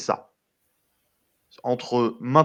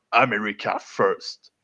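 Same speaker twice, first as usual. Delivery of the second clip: mock English-accented America First; a lightly English-accented America First; 2 semitones lower, slower than usual. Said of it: "Vous direz merci à mon accent anglais".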